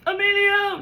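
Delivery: high loud call of a name. Retold or imitated. Quoting a movie, calling a name high and loud. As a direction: shout the name like a movie line. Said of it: "And I was like".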